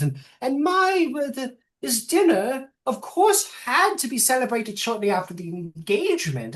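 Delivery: put-on a fluttery, slightly senile old matriarch; old gnome woman. GM voices the matriarch.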